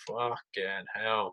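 slow, hushed, stretched-out swearing; drawn-out awe. Retold or imitated. Acting out reaction to growing explosions.

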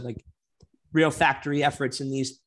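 normal talking voice

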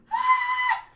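very high, loud panicked shriek; mock scream. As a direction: shriek in comic despair. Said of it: "there was an hour where I'm like".

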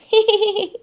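a quick high giggle; high carefree laugh. Acted. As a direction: laugh like a happy bug. Grasshopper laughs.

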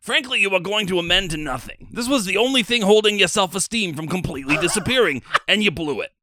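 director dressing down an actor, loud and cutting; stern director. Acted. Director rejecting Luigi's audition, in a stern mock voice. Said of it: "All right, sorry".

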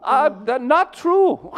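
slow, very high put-on voice; high-voiced visitor. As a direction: ask politely in a squeaky foreign-visitor voice.

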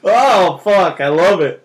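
loud, high burst of delight; a real whoop of joy at the memory; delighted outburst. Real, not acted. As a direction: shout out your glee at the joke.

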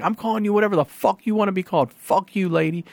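quick, high-pitched cussing out; retold angry comeback. Caller cussing out DMV clerk, angry comeback.

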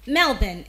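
Melbourne said MEL-bun; Melbourne with a weak second syllable, MEL-bun; correct pronunciation. Shown as a demonstration. Diction host modelling MEL-bun. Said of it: "It is pronounced as".

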